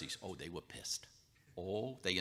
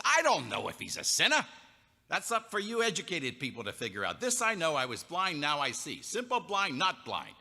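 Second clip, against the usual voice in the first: defiant retort; 6 semitones higher, 5 dB louder than usual. Acted. Healed man defies the Pharisees.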